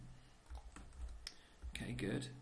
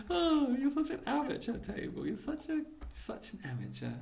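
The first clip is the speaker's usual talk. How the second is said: mock scolding; 13 semitones higher, 3 dB louder than usual. Mock insult to himself.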